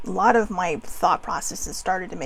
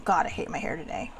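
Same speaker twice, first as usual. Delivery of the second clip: a low, soft, dejected grumble; mopey self-put-down; 6 semitones lower, 9 dB quieter than usual. Retold or imitated. Putting herself down at the mirror: low, soft, dejected. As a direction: mutter it into the mirror.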